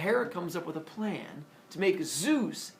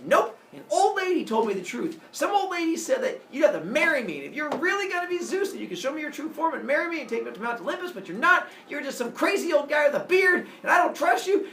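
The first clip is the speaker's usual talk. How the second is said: much higher, louder shouting rant; yelling; 11 semitones higher, 8 dB louder than usual. Acted. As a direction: tear into him at full volume. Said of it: "she starts yelling at him".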